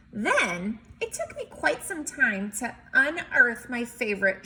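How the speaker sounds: lively picture-book read-aloud; story narration. A narrator's reading. Teacher reading a picture book to kids, lively narration.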